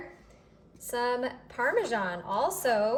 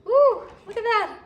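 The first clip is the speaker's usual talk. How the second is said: high, loud whoop; excited whoop; 11 semitones higher, 10 dB louder than usual. Real, not acted. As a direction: cheer before the bite. About to take bite.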